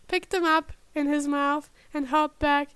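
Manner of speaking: plain reading voice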